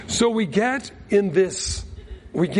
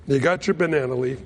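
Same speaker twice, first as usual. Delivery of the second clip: normal talking voice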